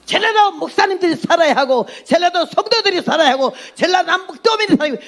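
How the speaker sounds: high-pitched rallying cry, phrase after phrase; impassioned exhortation. Retold or imitated. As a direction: re-enact a fervent appeal, pitched well up. Re-enacts her exhortation to Jeolla believers.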